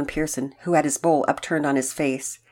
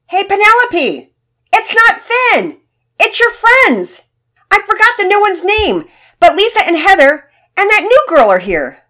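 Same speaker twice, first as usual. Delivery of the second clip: calling out; 14 dB louder than usual. A narrator's reading. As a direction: holler it across the house. Boy calls through the house.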